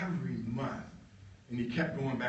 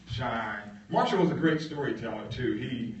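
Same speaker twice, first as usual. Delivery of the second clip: ordinary talk, nothing marked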